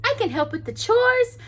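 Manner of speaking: high bright princess voice. A narrator's reading.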